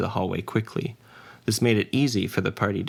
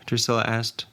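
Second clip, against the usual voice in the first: ordinary talk, nothing marked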